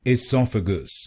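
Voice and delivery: "esophagus" as i-SOF-a-gus, short i, stress on sof; correct pronunciation. Shown as a demonstration. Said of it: "इसकी सही pronunciation है".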